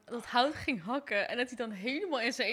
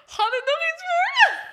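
high, squeaky laugh-crying; tears of laughter; 9 dB louder than usual. Real, not acted. Teasing while crying with laughter.

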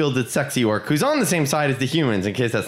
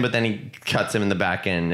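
ordinary delivery

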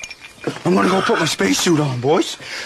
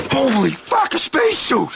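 excited; 7 semitones higher than usual. Real, not acted. Finds a spacesuit.

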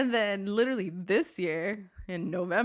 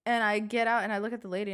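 normal talking voice

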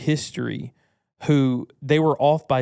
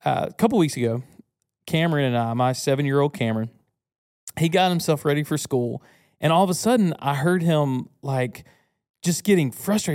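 ordinary talk, nothing marked

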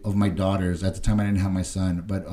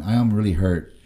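ordinary delivery

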